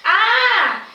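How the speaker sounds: a sharp, high, loud "Ah!" of pain; the son cries out as he falls, a loud high yelp; narrated scream. A narrator's reading. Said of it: "and screams".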